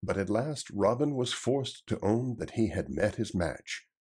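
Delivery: ordinary delivery, nothing marked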